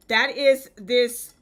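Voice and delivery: ordinary talk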